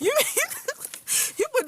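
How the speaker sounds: words broken up by real laughter; high, breaking off into laughter; laughing to tears. Real, not acted. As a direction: try to talk through helpless giggles. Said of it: "I'm almost crying".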